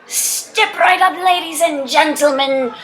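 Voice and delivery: loud, high carnival barker; street huckster. Acted. Opportunist selling supernatural repellents to passers-by, loud barker's pitch. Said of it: "there are opportunists who arise for every situation".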